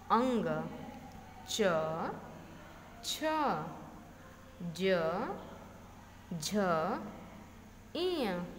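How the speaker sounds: each consonant from ग to ह said alone with its अ held; letter-by-letter pronunciation. Shown as a demonstration. Teacher demonstrating all the consonants to students, spaced-out letter recital. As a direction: recite ग, घ through to ह one at a time, long pauses between. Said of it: "तैंतीसों व्यंजन का जब हम pronunciation, उच्चारण किए हैं".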